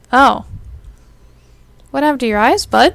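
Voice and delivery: sudden loud shocked oh; jolted by what's on screen; startled outburst. Real, not acted. Shocked at creepy game change.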